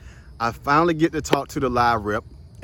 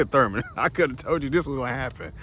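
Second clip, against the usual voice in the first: laughing; faster than usual. Retold or imitated. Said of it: "Somebody's going like".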